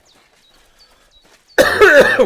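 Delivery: harsh loud coughing burst; choking cough, loud; loud coughing fit. Real, not acted. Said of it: "What the hell?".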